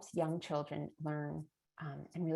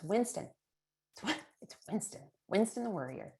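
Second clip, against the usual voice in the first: lightly voiced child, pitched up; a hint of a kid announcing a name; imitated child; 5 semitones higher, 4 dB louder than usual. Parent retelling child's instant answer, lightly imitated child voice. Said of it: "of my child ... immediately he goes".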